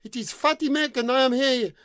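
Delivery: high, loud amplified announcement; shout through a loudspeaker. Acted. The prince announces himself through a loudhailer, loud. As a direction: blast it through a megaphone. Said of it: "switched it on and shouted".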